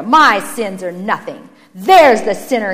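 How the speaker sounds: loud, heavy emphasis; self-righteous, forceful retort; scornful dismissal. Acted. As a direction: brush off the charge and accuse someone else. Brother deflects blame, scornfully.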